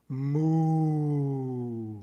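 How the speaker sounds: extended, drawn-out lowing; longer cow moo. Acted. Cow interrupts the monk's meditation again, longer mooing. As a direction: stretch the moo out long like a cow. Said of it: "And slightly longer".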